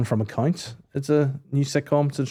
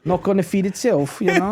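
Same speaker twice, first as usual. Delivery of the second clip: put-on high London street accent; mimicked Top Boy accent; 7 semitones higher than usual. Nagging partner about food, mimicked street accent. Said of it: "in that accent".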